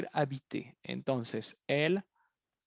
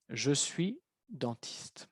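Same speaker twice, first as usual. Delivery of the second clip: slow, clearly spaced "Je suis dentiste"; slow model answer; 6 dB quieter, much slower than usual. Shown as a demonstration. Teacher modelling the answer for the class, slow and clear. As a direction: say "Je suis dentiste" word by word, slowly. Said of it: "answer said slowly as a model".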